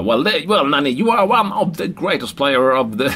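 high, hammed-up effusive interviewer; Spanish TV reporter. TV reporter heaping praise on a star player, hammed-up and long-winded. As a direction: gush grandly in mock reporter English. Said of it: "i Spanien sa".

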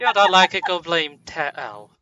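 loud mock-Texan retort; joking Texan accent, loud. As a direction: push the put-on Texan accent, loud and defensive. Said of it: "I kinda really like his accent".

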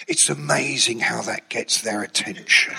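a hint of a low Cagney-style gangster; Don's gangster voice. Don boasting to the writer about his gun, lightly voiced gangster. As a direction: talk it like a tough guy. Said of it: "'cause he would talk like that".

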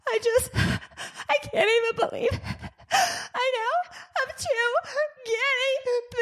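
high-pitched, gasping mock sobs; mock sobbing. Daughter overwhelmed by new guinea pigs, mock sobbing. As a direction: imitate a daughter blubbering through each phrase. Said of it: "breaks down and starts sobbing".

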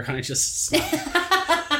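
laughing through the words; giggling as it comes out. Real, not acted. Laughing while telling.

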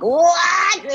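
a high, squealing "What?" of thrilled disbelief; excited squeal. Retold or imitated. Songwriter recalling hearing the freestyle, high excited squeal. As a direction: shriek it like you can't believe how good it is.

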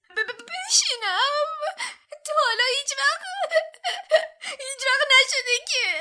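high, loud, stammering sobs; a house-elf overwhelmed to tears, wailing; wailing and crying. A narrator's reading. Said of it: "دابی با گریه و زاری گفت".

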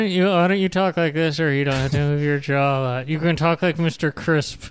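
put-on voice with a barely moving jaw; Mister Crisp. Shown as a demonstration. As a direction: talk without moving your jaw much, on a level pitch. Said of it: "This is how he talks".

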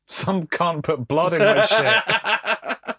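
slow, high, laughing shout; laughing outrage. Pretend. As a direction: spit it out through laughter.

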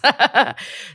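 high laugh after her own quote; amused laugh. Real, not acted. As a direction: laugh at your own story.

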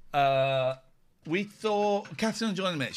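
usual speaking voice

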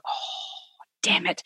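long breathy sigh, then soft curse; quiet, resigned groan of recognition; deflated dismay. Retold or imitated. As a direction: sink into a hushed, retold "caught out". Retold reaction to being caught out.

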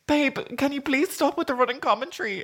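high, mock-desperate request; retold exasperated plea. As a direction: beg in a high, put-on voice. Pleading with doctor, retold.

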